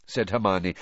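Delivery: ordinary delivery, nothing marked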